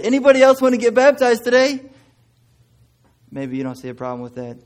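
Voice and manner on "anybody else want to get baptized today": high, loud, booming altar call; a minister's big invitation shouted to the church; loud preacher call. Retold or imitated. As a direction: call the crowd forward like an eager minister. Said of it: "the minister said".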